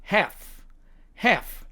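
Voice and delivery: American "half", front a, silent l; American demo of "half"; American accent. Shown as a demonstration. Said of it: "미국식 발음으로는".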